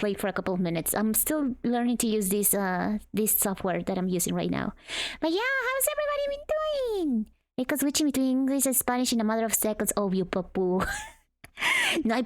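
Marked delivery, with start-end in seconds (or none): 5.2-7.3 high, bouncy greeting; too much caffeine. Real, not acted.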